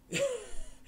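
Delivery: a quick amused laugh before answering; short laugh. Real, not acted. Reacting to a chat comment about Starship Troopers, a short laugh. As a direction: let out one brief real laugh.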